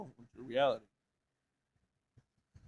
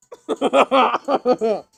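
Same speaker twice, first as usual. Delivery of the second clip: loud, higher leftover laughter; real giggling; 8 semitones higher, 16 dB louder than usual. Real, not acted. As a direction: laugh it off again. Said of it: "that caught me off guard".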